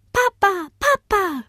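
high-pitched little one calling; excited child. A narrator's reading. Bodo's youngest spots his father, calling excitedly. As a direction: call out high like a small child. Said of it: "rief Bodos Jüngster".

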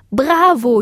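high, cheering exclamation; jubilant. A narrator's reading. Moon praises the girl's spell, jubilant. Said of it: "jubelte der Mond".

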